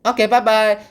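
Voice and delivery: high, loud hurried bye; panicked exit. Retold or imitated. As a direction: squeak it out and flee. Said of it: "and they're like".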